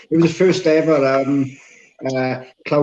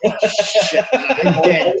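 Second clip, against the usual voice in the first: laughing mock fear; 5 semitones higher, much slower than usual. Reacting to giant's words.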